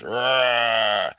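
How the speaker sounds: loud mock roar; playful lion roar for children. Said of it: "the lion comes over and goes".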